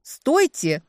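high, loud cry to halt; urgent cry. A narrator's reading. Woman keeping the kidnapper from hanging up, urgent cry. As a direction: cry out to stop him. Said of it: "успела выкрикнуть я".

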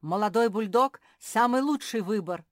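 very loud boast; loud, insistent brag; yelling. A narrator's reading. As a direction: sell yourself at full volume. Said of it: "завопил Семён".

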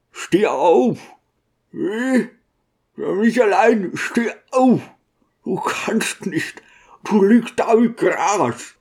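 high-pitched, tearful plea; anguished pleading. A narrator's reading. Deacon begging the dead man to rise, anguished. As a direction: implore slowly towards the end, voice pitched high.

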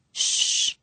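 soft breathy hush; quiet him down gently; a whispered shh; hushing murmur. A narrator's reading. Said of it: "he murmured".

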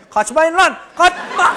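bellowed command to stop; shouted police order. Acted. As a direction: yell after fleeing suspects. Acting the police robot in pursuit.

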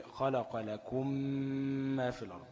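قراءة "خلق لكم" بإدغام الميم في الميم وغنة ممدودة بطيئة; اطبق الشفتين جامد على ميم "لكم" وطوّل الغنة حركتين; merged mim with ghunna. Shown as a demonstration. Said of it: "هتطبق شفتين وتتكة جامد كمان". ("لكم ما" بميم مشددة مغنونة ببطء شديد)

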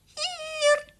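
very high mouse squeak. A narrator's reading. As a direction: answer in a tiny squeaky mouse voice. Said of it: "piepte Herman".

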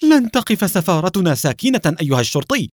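indignant. Acted. Alon threatens the policeman with his embassy, indignant outburst. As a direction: protest with rising anger. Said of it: "يقول في حنق".

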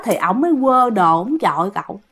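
usual speaking voice

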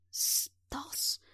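rasping, breathless whisper; hoarse whisper. Acted. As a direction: wheeze the name out weakly. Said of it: "прохрипел лежащий рядом Гельмут". (хриплый шёпот)

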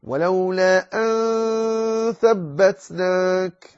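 ولولا أن ثبتناك very slow, أن thin and nasal; slow high recitation; correct light ikhfa. Shown as a demonstration. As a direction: recite the right way, slowly. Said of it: "لا تقل".

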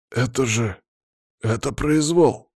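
halting, then high and drawn out; gasping disbelief, slowing on the last word; astonished. A narrator's reading. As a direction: react with stunned indignation. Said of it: "удивлённо произнёс Палыч".

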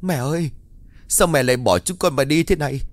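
hammed-up mourning cry; fake wailing. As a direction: wail for show so neighbours hear. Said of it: "đổi qua một giọng khác mà gào khóc".

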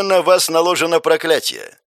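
ordinary delivery

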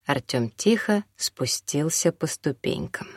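plain reading voice

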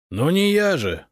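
loud, high, irritated retort; indignant and displeased. A narrator's reading. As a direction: snap back in annoyance. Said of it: "недовольно ответил Степан Богданович".

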